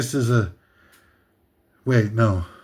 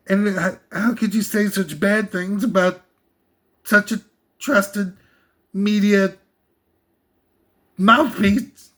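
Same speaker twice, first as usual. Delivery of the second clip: mock outraged fan; 5 semitones higher than usual. Continuing mocking outraged fan.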